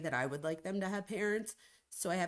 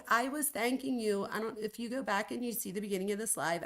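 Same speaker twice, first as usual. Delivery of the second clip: ordinary talk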